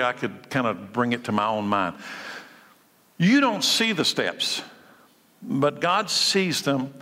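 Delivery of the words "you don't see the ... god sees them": slow, lifted contrast; slow emphasis. Acted. Pastor guiding listeners to picture steps, slow and deliberate. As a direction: draw out the contrast slowly. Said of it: "slow emphatic contrast".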